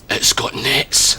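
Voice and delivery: slow, awed whisper of wonder; slow, hushed awe; deeply moved. Retold or imitated. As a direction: say it like seeing heaven. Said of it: "deeply moved".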